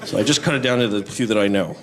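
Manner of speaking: ordinary talk, nothing marked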